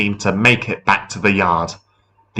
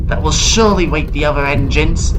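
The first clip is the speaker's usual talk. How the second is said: higher, quick character voice; Thomas talking to himself; 7 semitones higher, faster than usual. A narrator's reading. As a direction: think aloud in a higher voice, hopeful. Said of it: "Thomas said to himself".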